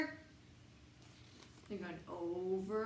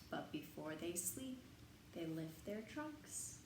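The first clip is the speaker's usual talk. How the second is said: much softer, lower bedtime voice; hushed; 5 semitones lower, 12 dB quieter than usual. Acted. Reader to children at bedtime, softly hushed. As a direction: lower it to a hush before sleep.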